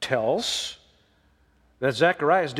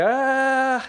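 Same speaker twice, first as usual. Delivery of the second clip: mock wavering God; 5 semitones higher than usual. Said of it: "God didn't say".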